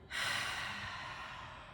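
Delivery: long breathy sigh out; big sigh. Shown as a demonstration. Teacher demonstrating the release, long sigh. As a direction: let it all go in one sigh. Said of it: "And sigh love and gratitude out".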